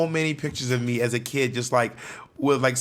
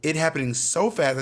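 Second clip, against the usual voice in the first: ordinary talk, nothing marked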